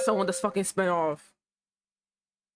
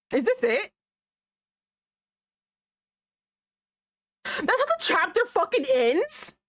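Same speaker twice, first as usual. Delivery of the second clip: disbelief; 11 semitones higher than usual. Real, not acted. Shocked by chapter ending.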